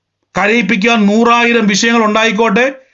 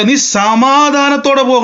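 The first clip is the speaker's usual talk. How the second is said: higher, slow proclaiming blessing; lifted blessing; 6 semitones higher, slower than usual. Retold or imitated. Eli sends Hannah away, high and slow. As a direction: raise the voice and stretch it out. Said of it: "ഏലി പറയുക".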